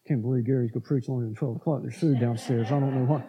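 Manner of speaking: soft, fast, low grumbling under the breath; modern grumble mutter. Retold or imitated. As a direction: complain quietly like a churchgoer who wants lunch. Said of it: "I'll make it modern for you".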